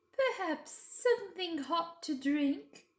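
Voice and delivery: high, slow, syrupy queen; scheming queen being gracious. A narrator's reading. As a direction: offer it sweetly and slowly, like a trap. The Queen's voice in narration.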